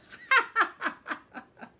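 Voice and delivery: loud high mocking cackle; bully goat laughs at the ogre, loud mocking cackle.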